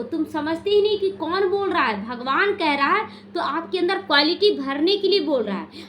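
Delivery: ordinary delivery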